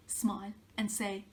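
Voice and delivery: ordinary delivery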